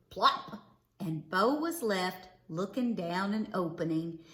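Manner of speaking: usual speaking voice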